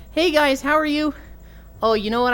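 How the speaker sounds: high, bright mock streamer greeting; chirpy put-on hello to chat; bubbly streamer. Streamer mimicking her friend's cheery greeting to chat. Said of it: "she'll be like".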